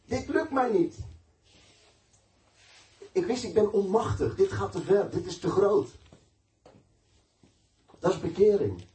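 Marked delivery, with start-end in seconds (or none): 0.0-5.9 high, straining plea of helplessness; retold desperate prayer. Preacher retelling his own prayer to God, high desperate plea. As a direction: beg God for help, voice pitched up and urgent. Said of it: "zei ik tegen God".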